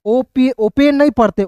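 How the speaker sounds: ordinary talk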